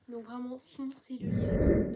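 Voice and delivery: loud, slow, insistent recommendation; loud and slow, like an order; emphatic urging. Real, not acted. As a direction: push them to go read it now. Urging viewers to read it.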